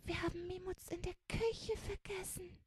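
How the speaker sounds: high, small plaintive voice; wailing. A narrator's reading. Child realises Mimutz was left in the kitchen, high hushed wail. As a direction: lament in a hushed, high whine. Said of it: "jammerte Fingerspitzchen".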